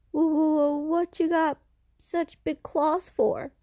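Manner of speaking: frightened stammer. Acted. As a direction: stutter in fear at the creature. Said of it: "The boy is so frightened".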